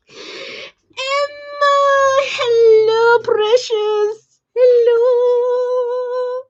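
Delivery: high, sung-out hello; greeting a viewer named Emma in a sing-song. Real, not acted.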